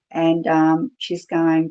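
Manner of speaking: normal talking voice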